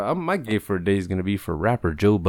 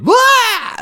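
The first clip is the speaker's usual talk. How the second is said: sudden loud, high-pitched "bwah" yelp; silly "blah" noise; 11 dB louder than usual. Shown as a demonstration. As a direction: blurt a drawn-out mock-singer "blah". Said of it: "I can't do that noise without laughing".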